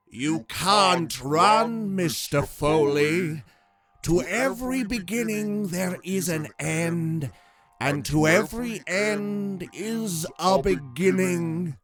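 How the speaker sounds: slow, commanding otherworldly voice; a being pronouncing fate, slow and heavy; booming voice of authority. A narrator's reading. Entity addressing Mister Foley, slow booming authority. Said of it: "His voice boomed again with the authority".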